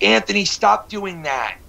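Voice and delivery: high, slow, weary teacher scolding; hint of a tired teacher telling a kid off. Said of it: "The teacher would be like".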